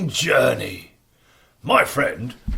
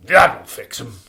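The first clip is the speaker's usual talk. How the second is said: smug giant; 5 semitones lower, 6 dB louder than usual. Acted. Giant smug about the notice.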